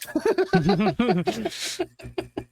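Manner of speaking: high rising laugh. Real, not acted. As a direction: cackle at the absurdity. Laughing at own story.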